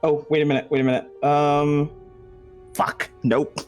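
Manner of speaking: ordinary talk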